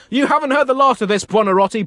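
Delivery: high, loud threatening shout; acted roaring threat of an angry Pope; furious blast. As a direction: blast him with indignation.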